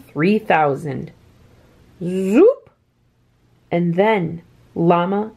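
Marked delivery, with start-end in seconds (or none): 2.0-2.7 bright rising zoop like a machine; zoop sound effect. A narrator's reading. The replicator cloning Alpaca, rising zoop.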